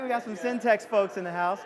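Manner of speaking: usual speaking voice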